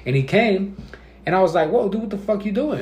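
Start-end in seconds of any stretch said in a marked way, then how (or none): 1.7-2.8 higher, aggressive challenge at a coworker. Retold or imitated. Worker confronting an unexpected coworker, retold aggressive tone. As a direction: snap at the surprise visitor. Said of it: "the way I was saying it was kinda, like, aggressive".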